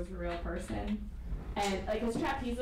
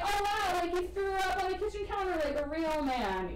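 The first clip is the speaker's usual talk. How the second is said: mock swoony gushing; 9 semitones higher than usual. Mocking swooning viewer.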